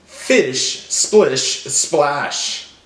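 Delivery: very slow, separated words. Shown as a demonstration. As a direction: draw out each final SH. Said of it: "they all end with the same sound".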